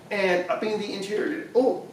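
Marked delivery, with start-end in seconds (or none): 1.5-1.9 coughing on a swallowed bug; sudden cough, choking on a bug; just swallowed a bug. Real, not acted. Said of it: "I think I just swallowed a bug".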